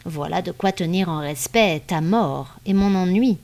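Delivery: usual speaking voice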